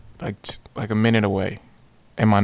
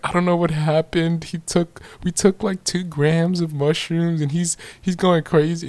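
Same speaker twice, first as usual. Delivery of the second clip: higher-pitched imitation of a worried girl; flustered girl; 8 semitones higher than usual. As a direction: voice her anxious explanation, pitched up. Retells the girl explaining in panic.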